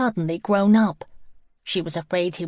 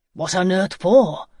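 louder, alarmed question; 7 dB louder than usual. A narrator's reading. Nick hears they have run away, alarmed. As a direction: let alarm ring in the voice. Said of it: "Alarm rang in his voice".

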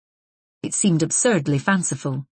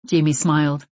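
usual speaking voice

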